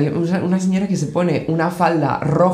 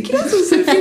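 imitating fan; slower than usual. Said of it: "le dice".

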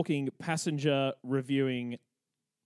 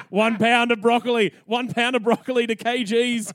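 loud cover-up; 5 semitones higher, 6 dB louder than usual. Retold or imitated. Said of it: "I'm just like".

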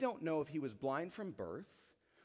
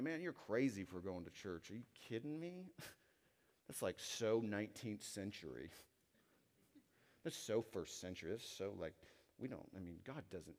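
scoffing family member; 8 semitones lower, 8 dB quieter than usual. Retold or imitated. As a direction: lower and much softer, a dismissive mocking mutter. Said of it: "family members telling you".

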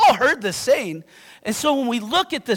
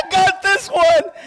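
laughing; 7 semitones higher, 4 dB louder, slower than usual. Real, not acted. Laughs, playful confidence.

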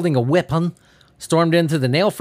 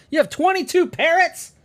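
high, incredulous question; mock astonishment; 13 semitones higher than usual. Asking the man with many parrots, mock amazed. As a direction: act floored by the number.

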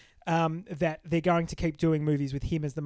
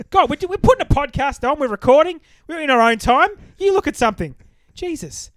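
mock outrage; 8 semitones higher, 6 dB louder than usual.

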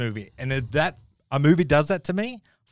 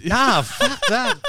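mock indignant; much slower than usual. Mock outrage at unemotional advice.

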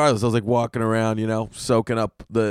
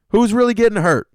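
louder and higher still; comic defender repeating the question even louder; bigger exasperated outcry; 10 semitones higher, 7 dB louder than usual. Acted. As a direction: push the repeat even harder.

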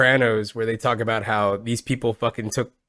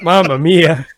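mock Italian exclamation; 4 semitones higher, 5 dB louder, slower than usual. Joking Italian stereotype.

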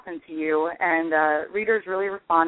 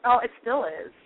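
ordinary talk

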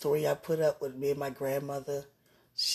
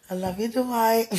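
higher and louder, playful delight; excited; 8 semitones higher, 10 dB louder than usual. Real, not acted. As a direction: burst out gleefully with his name. Repeats name playfully, much louder.

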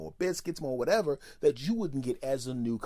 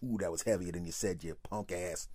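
low, much softer muttered insults; mean grumble; 9 semitones lower, 8 dB quieter than usual. Retold or imitated. Cursing the friend over the heavy package, low and under the breath. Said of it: "looked at him mean".

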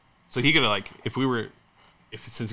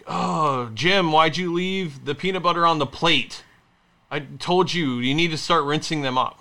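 higher, drawn-out exasperated complaint; mock nagging; 5 semitones higher, 5 dB louder, slower than usual. Husband imitating wife scolding him over a dirty plate, mock nagging. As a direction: imitate a fed-up spouse scolding.

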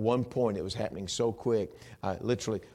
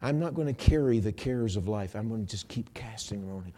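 ordinary delivery

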